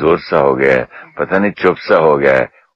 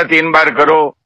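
retold order, pitched higher and a bit louder; loud command; 9 semitones higher than usual. Guest retelling how she ordered her family, raised loud command. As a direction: bark out the instruction as you did at home. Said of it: "जब मैंने जोर से बोला ना".

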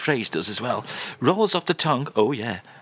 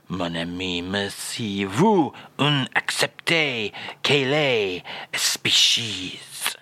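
[name]; 5 semitones lower, slower than usual. Acted. French guest obliges host, mock [name]. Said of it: "do that one more time".

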